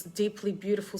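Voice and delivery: ordinary talk, nothing marked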